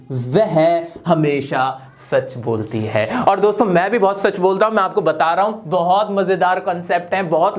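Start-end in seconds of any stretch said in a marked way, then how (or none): 0.1-3.2 slow, deliberate teacher's repeat; slow, low emphatic repeat. Shown as a demonstration. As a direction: drag out "वह हमेशा सच बोलती है" slowly and low.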